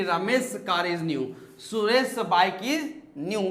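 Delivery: Ramesh car and Suresh bike read slowly, hissing s; slow hissing examples. Shown as a demonstration. Teacher slowly rereads both examples to show the hiss. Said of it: "hissing sound है".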